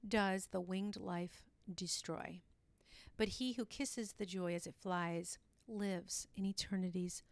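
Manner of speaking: slow, hushed poem reading; softly reciting a short poem; poem recital. Acted. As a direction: savour each line of the verse. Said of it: "it goes like this".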